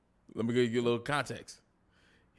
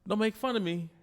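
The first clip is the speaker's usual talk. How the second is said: much higher mock whine; playful imitation of the wife; imitating Jada; 7 semitones higher than usual. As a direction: put on Jada's pleading voice. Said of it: "Then she said".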